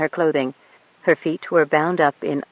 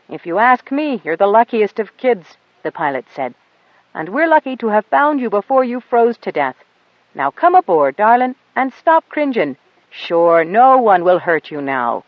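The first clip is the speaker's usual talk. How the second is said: louder, hearty and kind, with a folksy lilt; reassuring pilot; 5 semitones higher, 5 dB louder than usual. A narrator's reading. The pilot cheerfully coaxes the girl aboard, louder. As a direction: coax the scared kid aboard.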